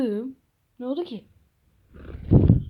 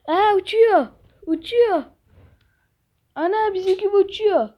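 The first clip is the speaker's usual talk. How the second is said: higher, louder amazed cry; 6 semitones higher, 3 dB louder than usual. Pretend. Kid amazed, watching the budgie fly overhead. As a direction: gasp in wonder at the bird.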